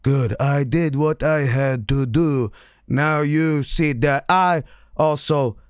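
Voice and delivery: slow, measured put-on speech; deliberate brooding antihero delivery; Shadow. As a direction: weigh out every word slowly. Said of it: "Shadow's like".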